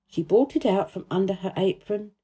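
ordinary delivery, nothing marked

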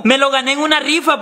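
usual speaking voice

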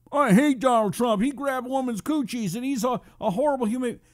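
high-pitched parody of an outraged complainer; mocking a critic. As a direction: whine it high, making fun of the objector. Mocks a critic of Trump.

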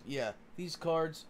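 ordinary talk, nothing marked

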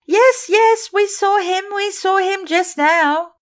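put-on high, loud overlapping girls' voices; all insist at once; cried out. The ballet girls cry out to Sorelli, high and insistent. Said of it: "cried the girls".

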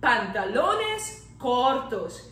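"pantalones cortos" slowly, Spanish r and o; slow Spanish model. Shown as a demonstration. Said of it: "Short pants".

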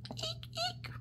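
high squeaky eek. Acted. Reading the noise list.

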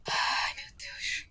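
slow, breathless whispered fear; terrified whisper. Real, not acted. Gamer frozen in fear, whispering. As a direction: barely breathe it out.

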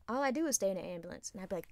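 normal talking voice

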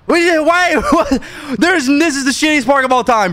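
high-pitched laughing disbelief; incredulous outburst. Real, not acted. Streamer griping to chat about a lousy park, incredulous. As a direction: protest in a high, incredulous squeal.

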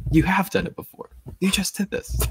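high-pitched, incredulous contradiction; indignant retort. Retold or imitated. Host arguing back at Hermione's false claim, indignant. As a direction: protest it up high, pushing on "have".

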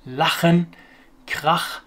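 German ch scraped hard in the throat on "lachen" and "krach"; hard throaty ch. Shown as a demonstration. Teacher showing the C H after O's and A's, hard throaty ch. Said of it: "this hard, throaty sound".

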